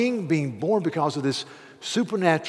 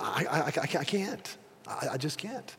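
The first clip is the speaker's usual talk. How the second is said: hushed halting refusal; 10 dB quieter than usual. Retold or imitated. Balaam refusing again, halting.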